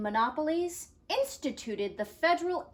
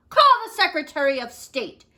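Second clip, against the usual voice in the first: loud, fast command; shouted; 5 semitones higher, 9 dB louder, faster than usual. Acted. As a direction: fire off the order. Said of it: "shouted President Taft".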